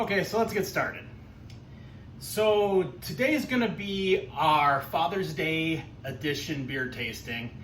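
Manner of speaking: ordinary delivery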